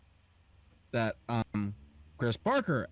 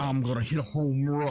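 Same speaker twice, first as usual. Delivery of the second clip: put-on voice; 3 dB louder than usual. Playful voice bit.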